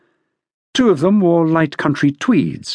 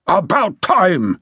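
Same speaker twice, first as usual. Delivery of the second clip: slow, higher-pitched angry complaint; 4 semitones higher, slower than usual. A narrator's reading. General greeting the late detectives, angrily. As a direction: draw it out in angry impatience. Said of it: "he said angrily".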